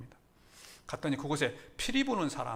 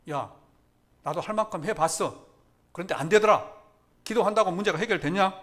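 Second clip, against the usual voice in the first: raised-voice scoffing, acted out; retold dismissive churchgoer; 5 semitones higher, 9 dB louder than usual. Preacher voices a half-hearted churchgoer's complaint, loud dismissiveness. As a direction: play the doubter challenging that prayer works. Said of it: "대충 왔다 갔다 한 사람이 이야기합니다".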